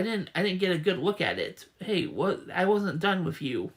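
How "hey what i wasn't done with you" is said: ordinary talk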